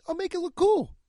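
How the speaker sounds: high, breezy put-on voice; hammed-up high voice of the painter; mock Danny Gamble. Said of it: "Danny Gamble would be like".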